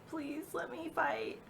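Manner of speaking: high, soft, drawn-out plea; begging. Retold or imitated. Mom begging the doctors to let her fight, pleading. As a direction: plead slowly in a small high voice. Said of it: "she was just begging them".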